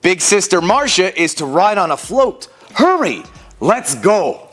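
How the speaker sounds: animated, theatrical picture-book reading; children's story narration. A narrator's reading. Storyteller reading a parade book to children, animated narration. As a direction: read it out with exaggerated swings of pitch.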